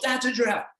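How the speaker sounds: pointing out her drawing proudly; mock proud child; daughter's voice. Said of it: "she goes".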